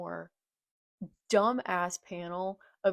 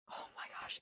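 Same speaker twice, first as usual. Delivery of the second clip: awed whisper; 16 dB quieter, much faster than usual. Real, not acted. Awed whisper while reading.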